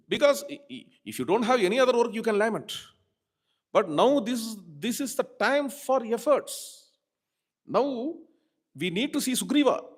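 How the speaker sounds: high, loud impatient scolding; a younger brother scolds the grieving Rama loudly. Retold or imitated. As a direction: shake him out of his grief. Lakshmana scolding lamenting Rama.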